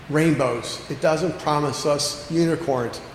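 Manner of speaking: usual speaking voice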